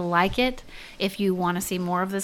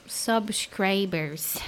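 drawn out; 5 dB quieter, much slower than usual. Acted. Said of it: "finishing the word slowly".

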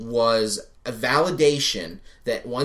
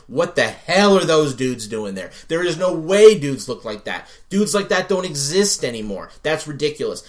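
loud, higher-pitched mock outrage; exasperated retold rant; 4 semitones higher, 7 dB louder than usual. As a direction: rant like a fed-up viewer at breaking point. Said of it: "almost at the breaking point of saying".

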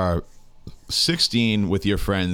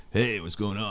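hippie voice; 6 dB quieter than usual. Retold or imitated. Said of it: "some fucking hippie walks up".

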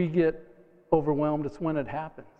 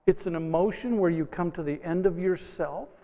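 ordinary delivery

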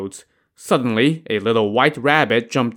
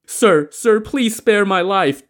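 high, louder frightened plea; begging; 9 semitones higher, 4 dB louder than usual. A narrator's reading. Defeated robber begs Li Kui, high and frightened. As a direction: beg for your life, voice up high. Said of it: "the man begged".

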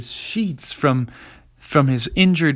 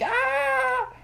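panicked squeal. A narrator's reading. Mom coming up.

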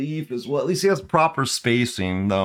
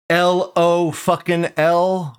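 "L O fucking L" slow and raised in pitch, word by word; mocking over-emphasis; 5 semitones higher, much slower than usual. Streamer showing mods what annoys him, "L O fucking L" drawn out. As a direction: spell out "L O fucking L" with every word stretched. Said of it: "couldn't emphasize it anymore".

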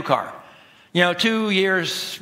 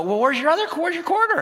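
puzzled dad; 13 semitones higher than usual. Retold or imitated. Dad questioning daughter.

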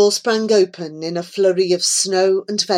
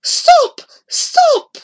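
high, loud, desperate shouts; frantic cry; 7 dB louder than usual. Acted. Horrified old man to the fiddler, frantic high cries. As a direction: cry out in panic.

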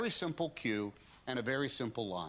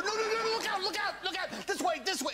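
high, urgent cries of look out; frantic warning. Shown as a demonstration. Woody warns his friends of danger, high frantic cries. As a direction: yell the warning as danger hits.

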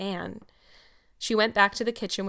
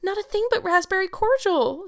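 very high, louder tearful protest; sobbing, acted; 12 semitones higher, 5 dB louder than usual. A narrator's reading. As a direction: wail it like a distraught girl. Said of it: "sobbed Anne".

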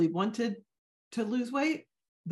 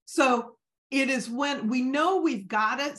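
ordinary talk

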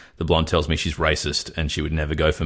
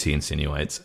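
ordinary talk, nothing marked

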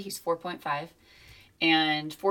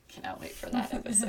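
excited whispered aside; 6 semitones higher, 3 dB quieter than usual. Real, not acted. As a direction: breathe it like a secret thrill.

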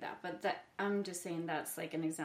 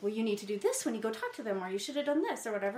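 quick, raised bossy-mom lecture; 5 semitones higher, 5 dB louder, faster than usual. Retold or imitated. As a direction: rattle off advice like a know-it-all mom. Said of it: "my mom part of me would be like".

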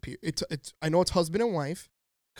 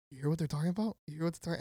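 softer, imitated furtive aside; confiding aside; 7 dB quieter, much faster than usual. Spying customer to the clerk beside him, softer aside. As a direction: drop the volume, as if unnoticed.

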